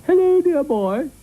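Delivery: high, slow, lowing cow greeting; mooing cow. A narrator's reading. Cow greeting the dog at the door, lowing. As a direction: draw it out like a genteel cow. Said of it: "she mooed".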